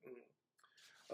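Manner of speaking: normal talking voice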